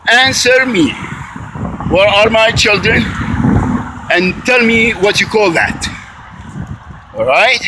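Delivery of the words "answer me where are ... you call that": raised pitch, pressing for an answer; higher, urgent confronting questions; real insistent demand. Real, not acted. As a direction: demand it of someone who is not there. Demands answers from absent other parent.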